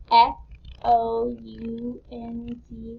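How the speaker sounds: each letter slow and separate; teacher spelling aloud; spelled out slowly. Shown as a demonstration. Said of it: "Let's spell it out loud".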